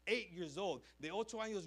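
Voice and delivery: usual speaking voice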